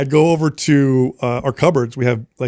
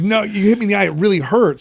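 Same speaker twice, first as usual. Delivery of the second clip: hammed-up pain, voice raised high; fake hurt dad, pitched up; 7 semitones higher than usual. Dad faking an eye injury to his son, pitched-up mock pain. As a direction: pretend the eye really hurts. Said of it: "I'm like".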